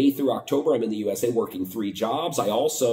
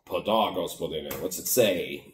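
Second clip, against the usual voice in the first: sarcastic inner thought; 5 semitones lower than usual. Retold or imitated. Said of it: "I didn't say that, but I'm thinking that".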